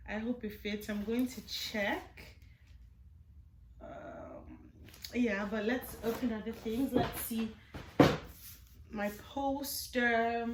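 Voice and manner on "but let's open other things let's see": usual speaking voice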